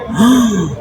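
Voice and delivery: high, awed "oh"; amazed gasp. Real, not acted. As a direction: let the wonder burst out. Awed by the station. (émerveillé)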